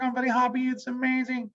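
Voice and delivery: very high, fast squeal of joy; high squishy happy voice; squeaky excited voice. Shown as a demonstration. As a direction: squeal it like an overexcited person. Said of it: "you can be like".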